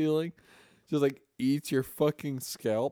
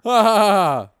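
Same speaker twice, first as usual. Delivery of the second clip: laughing; 7 semitones higher, 16 dB louder than usual. Real, not acted. Bursts into laughter.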